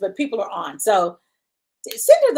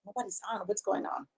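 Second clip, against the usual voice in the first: much softer, puzzled under breath; baffled murmur; 3 semitones lower, 13 dB quieter than usual. Retold or imitated. Retelling talking to herself about no viewers, hushed. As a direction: murmur it to yourself. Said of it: "but I was like".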